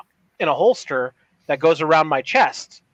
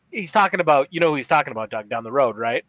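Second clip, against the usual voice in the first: ordinary delivery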